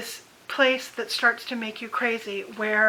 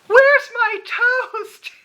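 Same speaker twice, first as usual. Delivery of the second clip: much higher, louder and very slow, indignant; demanding mother; 11 semitones higher, 10 dB louder, much slower than usual. Retold or imitated. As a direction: demand the toast, stretched out. Mother demanding toast third time.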